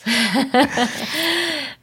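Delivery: high laugh trailing into breath. Real, not acted. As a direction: let out a high, airy laugh. Se ríe tras su petición. (risa aguda con mucho aire)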